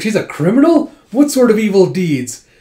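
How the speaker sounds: high, loud acted astonishment; shocked questioning. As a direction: question it in disbelief.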